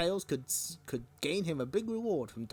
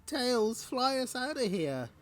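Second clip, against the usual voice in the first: high-pitched, small cartoon mouse voice; pleading little mouse; 9 semitones higher, slower than usual. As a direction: squeak out a plea for rescue. Small mouse begging to be rescued.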